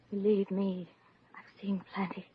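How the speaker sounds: hushed, breathless whisper; whispered confession. Acted. Shy writer whispering her secret to Maisie. Confiding a secret about spying.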